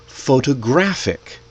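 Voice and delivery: "photographic" stressed on GRAPH; third-syllable stress. Shown as a demonstration. Teacher shows learners word stress, photo-GRAPH-ic. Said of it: "we put the stress in different places".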